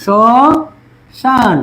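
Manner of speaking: শ্মশান stretched out very slowly, ম silent; slow pronunciation demo. Shown as a demonstration. Teacher showing class how শ্মশান is said, very slowly drawn out. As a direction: draw শ্মশান out slowly, saying it as শশান. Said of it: "তাহলে কি হয়ে যাবে?".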